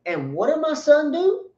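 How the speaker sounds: lightly acted proud father, rising up; a hint of a defiant father. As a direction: throw it back at the media with a light touch. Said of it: "looked the media in the eye".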